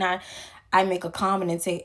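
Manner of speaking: ordinary talk, nothing marked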